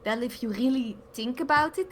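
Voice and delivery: thick Dutch-accented English; strong Dutch accent. Shown as a demonstration. Demonstrating a strong Dutch accent for friends, thick Dutch accent. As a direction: lay the Dutch accent on heavily. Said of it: "an example of, like, a strong Dutch accent".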